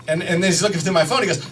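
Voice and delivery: normal talking voice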